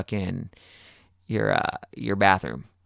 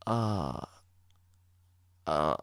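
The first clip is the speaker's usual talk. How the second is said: guilty awkwardness; much slower than usual. Retold or imitated. Guilty brother hears about the used razor, awkward hesitant agreement. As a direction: imitate someone guilty playing innocent. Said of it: "weird-ass look on his face".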